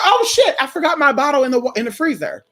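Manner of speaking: a high startled gasp of oh shit, then fast; remembering the bottle in the freezer all of a sudden; sudden realization. Retold or imitated. Telling herself about the forgotten bottle, startled.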